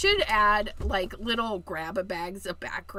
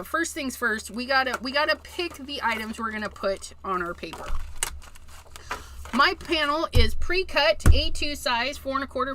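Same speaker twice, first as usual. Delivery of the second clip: ordinary delivery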